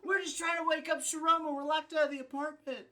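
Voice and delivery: high, strained shout back; mock yelled plea. Locked-out friends answering the angry neighbour, high mock yelling. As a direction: imitate calling up in protest. Acted reply to screaming neighbour.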